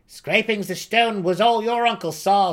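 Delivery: high, loud scoffing gnome; mocking gnome. A narrator's reading. Seldig scoffs at the boy's uncle story, high and mocking. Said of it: "Seldig laughed".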